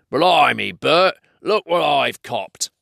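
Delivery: mildly astonished troll; surprised troll. Acted. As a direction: show off a surprise catch to your mate. Troll finds and grabs Bilbo.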